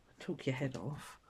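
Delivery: normal talking voice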